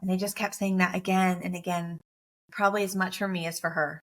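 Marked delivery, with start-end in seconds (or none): none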